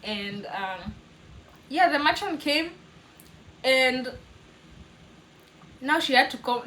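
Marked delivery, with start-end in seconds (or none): none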